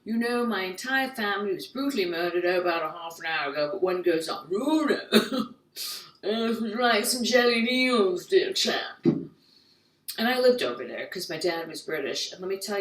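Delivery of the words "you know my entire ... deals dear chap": mock English composure with stretched vowels; stiff-upper-lip English. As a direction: play an unruffled English chap. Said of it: "English people could be like".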